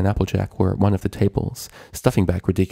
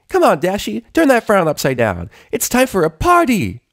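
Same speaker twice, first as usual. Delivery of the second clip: much higher, louder bubbly cheer; boisterous Pinkie; 13 semitones higher, 5 dB louder than usual. A narrator's reading. Pinkie Pie cheering up gloomy Rainbow, boisterous. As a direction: bounce in full of party energy. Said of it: "an ever-boisterous Pinkie Pie".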